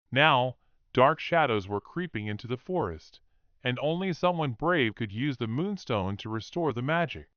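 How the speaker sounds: ordinary delivery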